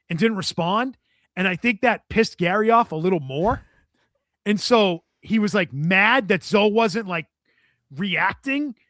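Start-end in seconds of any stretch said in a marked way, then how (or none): none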